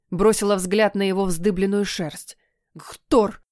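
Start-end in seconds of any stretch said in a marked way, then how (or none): 2.7-3.4 hissed unvoiced curse word; muttered curse. Cat swearing with his eyes screwed shut. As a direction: spit out the curse under breath. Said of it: "ругнулся он".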